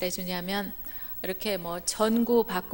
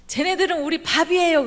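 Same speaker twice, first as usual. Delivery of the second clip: high, loud swaggering brag; a confident taunt, pitched up; cocky boast; 7 semitones higher, 6 dB louder than usual. Retold or imitated. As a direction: sneer at the giants as easy prey. Spies boasting about giants.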